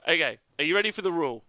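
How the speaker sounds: ordinary talk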